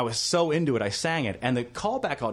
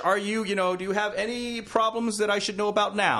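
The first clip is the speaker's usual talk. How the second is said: casting director voice; 6 semitones higher than usual. Retold or imitated. Casting director quizzing him.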